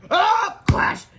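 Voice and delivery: high, loud cartoon scream, then a shouted crash; hammed-up disaster noises. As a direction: cry out, then boom the impact.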